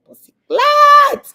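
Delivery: high, slow, stretched squeal of disbelief; shocked squeal. Real, not acted. Reacting to the argument on the show with a stretched shocked squeal.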